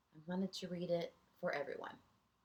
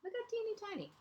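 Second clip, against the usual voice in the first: way up high to show how small; a tiny, squeaky delight; squeaky teeny-tiny voice; 14 semitones higher, 3 dB quieter, much faster than usual. Acted. Showing a tiny picture to children.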